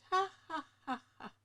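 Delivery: a dry, mocking ha ha ha; sarcastic laugh. Real, not acted. Annoyed at a late delivery, a sarcastic laugh. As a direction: laugh off the broken promise.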